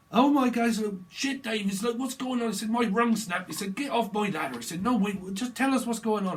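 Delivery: loud, high lads yelling; excited shouting. Retold or imitated. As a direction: yell it over each other, excited. Acted lads yelling on ladder.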